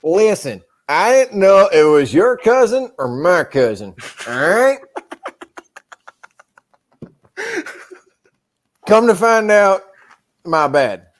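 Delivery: hammed-up southern twang; southern accent. Owning up about the cousin, southern accent. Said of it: "making fun of the southern accent".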